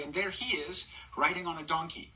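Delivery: ordinary delivery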